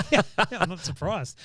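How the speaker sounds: talking through a real laugh, high and loud. Real, not acted. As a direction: laugh out the reply. Amused at the guest's joke.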